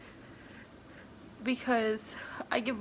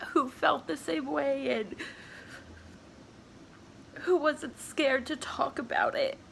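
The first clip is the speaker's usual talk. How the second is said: higher voice, halting through real tears; crying; 5 semitones higher than usual. Real, not acted. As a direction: talk through real crying, pausing to get the words out. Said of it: "I'm sorry for crying".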